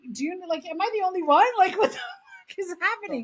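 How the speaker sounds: high, rising disbelief breaking into laughter; retold incredulity. Teenager wondering if she's the only one laughing, incredulous.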